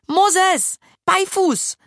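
high, loud despairing call; shouting a dog command at a pig, desperate. A narrator's reading. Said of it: "rief Lille verzweifelt".

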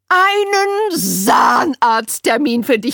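high, loud fussing lady voice; tut-tut like a fussy auntie; overbearing social worker, appalled; Winnie. A narrator's reading. Winnie announces.

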